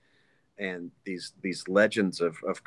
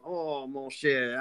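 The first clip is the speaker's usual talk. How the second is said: slow Cajun purr on mon cher; Cajun Gambit voice; 4 semitones higher, much slower than usual. Shown as a demonstration. Voice actor showing his Gambit audition, Cajun accent. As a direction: lean into Gambit's Cajun charm, slowly. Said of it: "I had to become Gambit".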